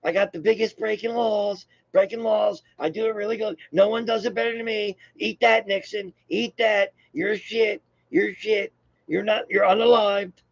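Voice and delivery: high-pitched caricature of a bragging politician; Trump. Acted. Vlogger playing Trump bragging over Nixon, high-voiced mockery. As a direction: keep it high and self-satisfied, a boastful parody. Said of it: "Trump, you know?".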